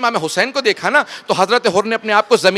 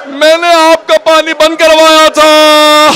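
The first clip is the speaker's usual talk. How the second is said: anguished wailing plea; 8 semitones higher, 5 dB louder, slower than usual. Acted. Hur confesses weeping before Hussain.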